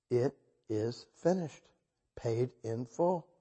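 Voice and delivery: slow, soft, measured quote; solemn pronouncement. Retold or imitated. Preacher quotes Jesus on the finished work, solemn and hushed. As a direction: let each word settle quietly.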